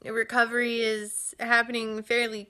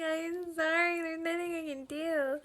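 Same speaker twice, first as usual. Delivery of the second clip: softer, higher helpless apology; sorry to baby; 6 semitones higher, 6 dB quieter than usual. Retold or imitated. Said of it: "I was just like".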